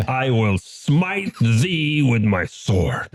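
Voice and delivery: slow, drawn-out heroic declaration; mock-heroic captain. Captain drawing his sword for battle, mock-heroic. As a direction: proclaim it grandly, stretching the words. Player declares attack in character.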